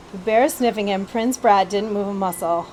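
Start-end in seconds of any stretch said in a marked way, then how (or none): none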